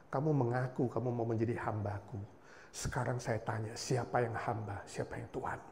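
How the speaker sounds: gentle whisper, fading to breath; whispering Spirit. Retold or imitated. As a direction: murmur it like a voice inside the heart. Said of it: "Roh Kudus berbisik di hati saya".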